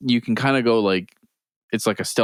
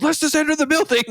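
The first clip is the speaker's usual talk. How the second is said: high dismissive retort; mocking player. Enemy player teases the role-player, high and dismissive. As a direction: brush him off, amused.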